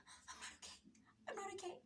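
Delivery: breathless whispered plea, barely voiced; panicked whisper. To mom and sister at the gate, frightened whisper. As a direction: whisper it like you can hardly get air.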